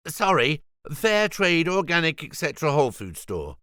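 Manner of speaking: a harried vegan owner answering; Julian's voice. Acted. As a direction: rattle off the shop name. Julian answers the shop phone.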